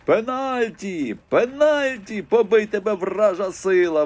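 shrieking. A narrator's reading. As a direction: screech it like an outraged granny. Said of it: "верещала баба Маруся". (баба Маруся верещить на суддю)

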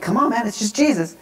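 high-pitched urging, held down in volume; impatient coaxing. Retold or imitated. Youth worker prompting sobbing teen, impatient coaxing. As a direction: egg him on toward the answer.

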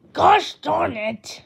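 loud, slow frustrated yell; frustrated outburst. Acted. Grounded kid yelling in loud, slow frustration. Kid's reaction to being grounded.

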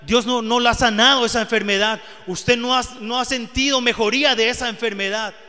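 loud, high, urgent preacher's appeal; fervent preaching. Acted. A preacher pleading loudly with the congregation. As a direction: press the congregation about their illness.

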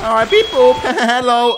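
normal talking voice

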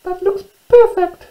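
very high, loud, delighted squeak; squeaking little mouse. Acted. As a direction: squeak it as a pleased tiny mouse. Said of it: "she squeaked".

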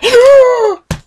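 sharp high gasp of fright; startled horror gasp. Real, not acted. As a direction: suck in air in sudden terror. Jump scare in horror game.

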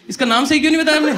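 high, loud, fast disbelief; incredulous shout. Retold or imitated. Policemen glare at the woman, high fast loud demand.

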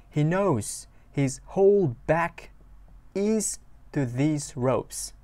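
very slow, drawn-out English line; careful, unhurried teacher read; slow lyric reading. Shown as a demonstration. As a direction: read each word slowly for learners. Teacher reads lyric slowly.

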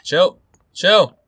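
two loud, high single-word shouts; alarmed call. Real, not acted. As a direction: call out to make them back off. Calls out under attack in game.

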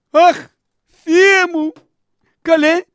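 high, loud cries of fright; hammed-up panicked exclamations; startled alarm. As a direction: jump with shock, voice shooting up. Startled by the mourner's sudden cry.

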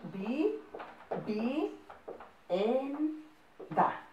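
vivienda split into vi, vi, en, da, softly; quiet syllable dictation. Shown as a demonstration. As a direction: break vivienda into its syllables for spelling. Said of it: "las dos con V".

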